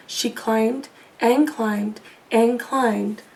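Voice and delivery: ordinary delivery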